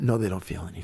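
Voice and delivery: low, soft dismissive reply; dismissive grandmother. Retold or imitated. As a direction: brush the kid off, low and flat.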